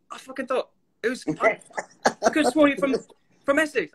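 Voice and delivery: high-pitched imitation of a British friend; British accent. Actor retelling the friend's confusion, high mock-British accent. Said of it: "my British friend James Bourne".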